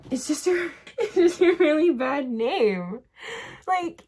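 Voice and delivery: high, laughing apology. Real, not acted. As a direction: say it through giggles. Laughing while apologizing for mocking name.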